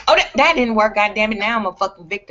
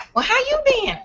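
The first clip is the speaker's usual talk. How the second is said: much higher, clingy mama voice; mock mother. Mother calling her daughter, in a much higher voice. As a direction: imitate the needy mom.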